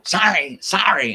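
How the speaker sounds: high, loud mocking sorry; mock apologizer. Mocking shoppers who keep apologizing, high sorry. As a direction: parrot the constant apologizer.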